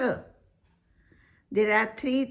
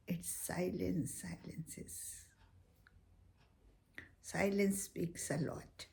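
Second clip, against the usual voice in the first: fading into a near-silent whisper; 11 dB quieter than usual. Acted. Teacher whispering about silence to end the poem. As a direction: let the voice die away into silence. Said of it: "reflecting on silence".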